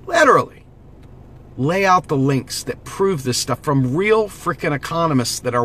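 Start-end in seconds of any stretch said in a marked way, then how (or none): 0.1-0.6 high, loud burst on "literally"; exasperated, high-pitched outburst of "literally"; exasperated emphasis. Real, not acted. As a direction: punch "literally" way up high, then drop. Exasperated at critics, repeats the word.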